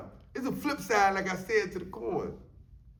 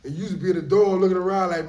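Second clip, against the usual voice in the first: ordinary talk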